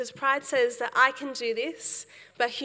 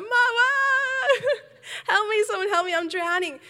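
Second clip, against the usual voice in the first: much higher, louder crying cry for help; screaming for mum; 10 semitones higher, 7 dB louder than usual. Retold or imitated. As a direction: shriek for mum like a drowning child. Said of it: "I'm screaming. I start crying".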